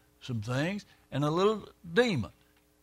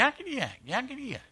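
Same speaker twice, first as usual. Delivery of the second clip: jabbering imp; 9 semitones higher, faster than usual. Retold or imitated. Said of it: "he'd jump up and down and go".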